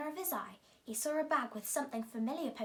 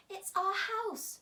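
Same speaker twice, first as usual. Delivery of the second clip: much higher, slow squeaky mother mouse; 10 semitones higher, 4 dB louder, slower than usual. A narrator's reading. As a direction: squeak it like a worried little mouse. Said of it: "squeaked the mother mouse".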